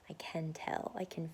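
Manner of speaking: hushed, breathy confession; whisper. Real, not acted. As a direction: whisper it like a secret. Confiding how she feels.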